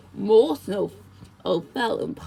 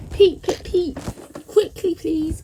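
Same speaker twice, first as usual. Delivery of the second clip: loud high peeps, then an urgent call; 6 semitones higher, 5 dB louder than usual. A narrator's reading. Engine calling passengers to get out quickly, loud and urgent. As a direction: sound the whistle and hurry them along. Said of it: "called Thomas".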